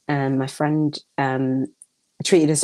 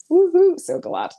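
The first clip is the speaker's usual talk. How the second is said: excited whoop; 14 semitones higher, 4 dB louder than usual. Real, not acted. Cheering a viewer's comment.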